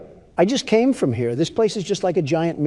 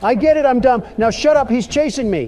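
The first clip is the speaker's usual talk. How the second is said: high, raised, frantic; being chased; 8 semitones higher, 3 dB louder than usual. Real, not acted. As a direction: snap back while fleeing. Fleeing while arguing.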